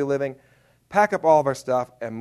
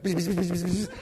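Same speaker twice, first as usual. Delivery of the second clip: imitated electric zapping; short buzzes, softly imitated; bug zapper buzz; 2 semitones higher, 4 dB quieter than usual.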